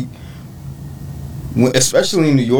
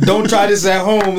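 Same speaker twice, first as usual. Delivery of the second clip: mock warning label; 7 semitones higher than usual. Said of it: "You got something like the".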